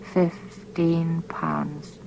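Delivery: slow, barely-there breathless whisper; silent mouthing. A narrator's reading. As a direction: mouth the words almost without sound. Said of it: "His lips formed the silent words".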